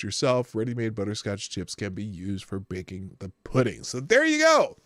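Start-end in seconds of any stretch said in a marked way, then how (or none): 3.8-4.8 cheery announcement. Real, not acted. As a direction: sell it like a holiday host. Cheery sign-off to viewers.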